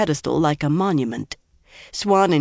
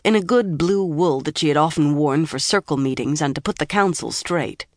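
usual speaking voice

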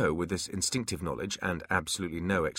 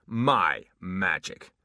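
slow gloating; 3 dB louder, much slower than usual. Acted. Demon gloats.

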